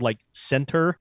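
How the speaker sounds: "center" with a clear T in the middle; T pronounced. Shown as a demonstration. Example word for the dropped-T habit, T pronounced. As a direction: say "center" with the T sounded. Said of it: "leave out, like, the, the T".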